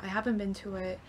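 ordinary delivery

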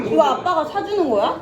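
high, loud, through laughter; laughing question. Real, not acted. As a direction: laugh while asking. Teasing dad about paying.

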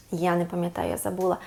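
soft mock forgetting. Teacher pretends to forget, hushed, for the child.

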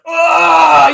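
sudden high, loud cry; pained yelp. Retold or imitated. Dad in the stands after his son's bad play, pained yelp. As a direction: yelp like you took a hit.

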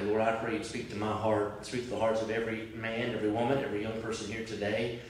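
low, hushed pastoral prayer. Acted. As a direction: pray it softly and earnestly over the room.

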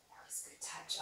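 whispered praise to a dog; whispering. Real, not acted. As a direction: breathe the praise softly.

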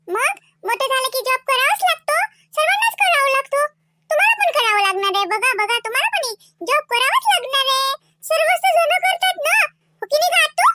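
put-on high, singsong child voice; know-it-all little niece. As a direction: lecture a grown-up like a small girl. Little niece lectures uncle.

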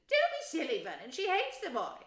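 high, loud, impatient aunt; scornful dismissal. A narrator's reading. Wife dismissing her husband's idea, impatient and shrill. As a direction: shoot the idea down sharply.

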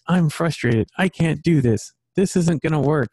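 imitating my son giving up; mock frustrated kid.

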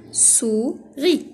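"Souris" as two beats, "sou" then "ris"; split into syllables. Shown as a demonstration. Teacher showing where the word divides, "Souris" split in two. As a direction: say "Souris" with a gap between its syllables. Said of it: "Je découpe les mots en syllabes".

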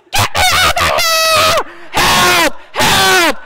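very high, drawn-out terrified scream; reenacted shriek of panic; screaming at top of lungs. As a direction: scream for rescue, full volume. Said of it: "I screamed at the top of my lungs".

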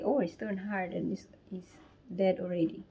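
soft whisper, a little sad. Real, not acted. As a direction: whisper over the dead fish. Finds the fish dead.

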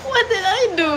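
innocent high pet voice; dog's voice. Retold or imitated. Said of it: "Chill is like".

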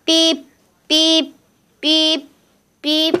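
imitated alarm beeping. Child plays her alarm going off, mock electronic beeping. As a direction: beep like an alarm clock.

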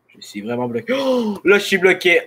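high, anxious and stuck; panicked. Real, not acted. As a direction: cry out, trapped and scared. Stuck while zombies attack.

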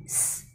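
s as a soft voiceless hiss; isolated final sound. Shown as a demonstration. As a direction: release the s alone, very softly. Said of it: "cuối cùng nó là âm s".

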